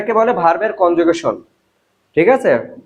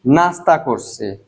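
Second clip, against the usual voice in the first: নাস্তা করছি said slowly, lower; slow answer demo; 5 semitones lower, slower than usual. Shown as a demonstration. Teacher acts the answer to own question, slowly. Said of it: "আমি কি করছি?".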